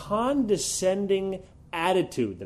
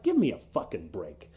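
scoffing dismissal; 7 semitones lower, 3 dB quieter than usual. Retold or imitated. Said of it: "I'm just like".

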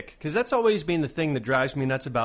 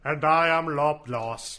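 alien voice; slower than usual. Acted. Second alien persona.

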